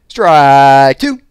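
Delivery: very loud umpire bellow; umpire's shout. A narrator's reading. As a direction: shout the strike across the diamond. Umpire calling strike.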